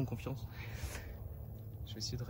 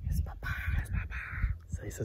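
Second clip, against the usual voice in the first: whispered; 5 dB quieter, faster than usual. Real, not acted. Whispering joy after good shot.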